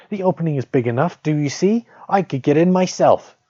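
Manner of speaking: put-on high, taunting old witch. A narrator's reading. Witch goading Gretel toward the oven, high and taunting. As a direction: sneer it up high like a crone.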